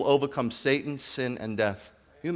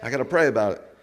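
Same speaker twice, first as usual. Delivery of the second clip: usual speaking voice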